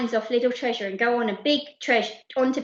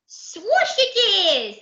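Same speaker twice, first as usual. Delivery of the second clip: put-on high, lisping toddler voice; a tiny boy blurting out his favourite food; baby voice; 9 semitones higher, 4 dB louder than usual. Toddler answers the pirates in a high baby voice.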